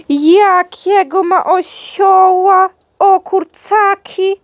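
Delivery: high, loud, slow pet talk; excited gushing. Real, not acted. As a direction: gush over the dog's toy, stretching the words. Gushes over dog's new toy.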